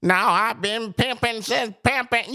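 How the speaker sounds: high-pitched exaggerated pimp talk; Cat Williams. Host mocks a comedian's stage act, exaggerated pimp voice. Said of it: "don't talk like that".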